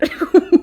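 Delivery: loud, high incredulous laugh; a real laugh of disbelief; burst of laughter. Real, not acted. Reviewer laughing at the absurd plot twist, loud laugh.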